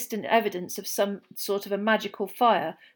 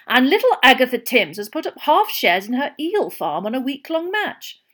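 loud, high hearty bookmaker; jovial Bagman; 3 semitones higher, 9 dB louder than usual. Acted. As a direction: boast gleefully about the odds. Bagman boasting bets.

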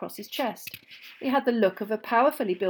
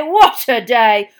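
bigger exclamation; 2 semitones higher, 14 dB louder, much slower than usual. Acted. Repeated, slower, far voice.

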